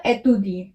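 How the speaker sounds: "एतुदिए" starting with ay: ay-tu-dee-ay; accented first vowel. Shown as a demonstration. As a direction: open "एतुदिए" on a clear French é. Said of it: "क्या हो जाएगा?".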